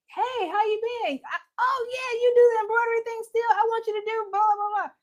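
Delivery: very high, chirpy mock voice; imitated acquaintance. Old acquaintance asking host for embroidery, high mocking imitation. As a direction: squeal the request, trailing into blahs. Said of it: "they're like".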